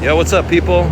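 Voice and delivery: high, loud holler across the road; loud friendly shout at passersby; yelling at a bus. Real, not acted. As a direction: belt it to get the bus's attention. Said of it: "Not that loud".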